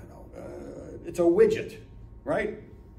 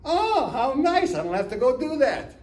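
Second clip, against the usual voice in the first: delighted customer; 10 semitones higher, 7 dB louder than usual. Retold or imitated. Customer delighted the batteries are included, gushing. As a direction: gush with pleased surprise.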